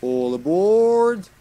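loud, rising, drawn-out call; long high announcing shout; mock conductor call. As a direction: holler it like a train conductor. Calls out like train conductor.

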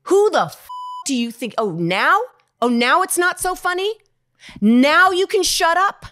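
high, very loud enraged rant; scornful shouting, building to a drawn-out yell; retold yelling. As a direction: re-enact an actor blowing up at someone on set. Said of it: "He yelled this".

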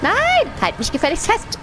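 sharp high no, then demanding; alarmed shout. Real, not acted. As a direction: yell in panic, then scold. Seil rutscht, Figur droht zu fallen. (empörter Aufschrei)

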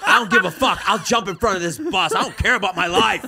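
loud, high mimicry of a daredevil friend; hammed-up bravado at high volume; mock reckless bravado. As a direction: exaggerate it, belting out each reckless boast.

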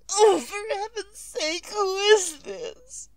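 terrified woman facing a silent caller, sobbing; tearful panic. Acted. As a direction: plead into the silent phone, near breaking.

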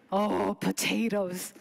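slow, drawn-out put-on reply; slow imitation of mom; imitated mother's answer. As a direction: draw it out innocently, as the mother. Mother's answer about her food.

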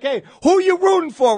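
imitated fan asking. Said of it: "anyone is like".

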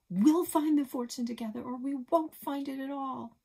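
high, loud desperate cry; screaming. A narrator's reading. Chase screaming at dying Buck, high and loud. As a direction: scream it with all her heart. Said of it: "Chase screamed".

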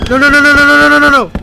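high, frantic stream of cries; panicked. Real, not acted. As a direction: blurt it in panic while fleeing. Fleeing to the safe room.

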